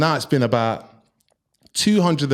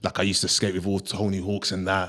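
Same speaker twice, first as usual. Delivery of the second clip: put-on deep voice, much lower; 8 semitones lower, 4 dB quieter than usual. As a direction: lower the voice to impress her. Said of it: "I had to put a deep voice on".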